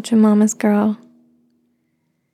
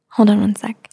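whispering. Real, not acted. Aside while on the phone.

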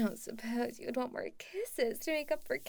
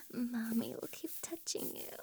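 soft intimate ASMR whisper; 6 semitones lower, 6 dB quieter than usual. Acted.

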